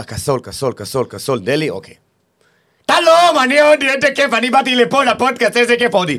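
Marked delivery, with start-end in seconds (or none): 2.9-6.2 high, loud hammed-up Indian voice; mock Indian accent. Comedian asked on a podcast to do an Indian, hammed-up high accent. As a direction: ham up a cartoon Indian tourist. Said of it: "תעשה לנו חיקוי של הודי".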